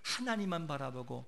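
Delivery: usual speaking voice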